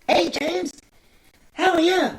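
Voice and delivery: very high, fake-sounding imitation; high CIA guy voice. CIA guy greeting James Bond, high weird voice. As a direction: attempt a silly high-pitched agent voice. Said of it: "And it's that high voice".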